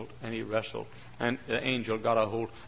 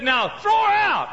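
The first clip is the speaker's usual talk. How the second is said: high, slow, loud command; angry order; 9 dB louder, slower than usual. Acted. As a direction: bark the order to throw her out. Judge orders her thrown out.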